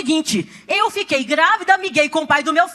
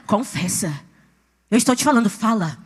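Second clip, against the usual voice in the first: low, hushed, firm order; commanding inner voice; 6 semitones lower than usual. Retold or imitated. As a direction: drop low and press for the confession. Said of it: "a voz fala comigo".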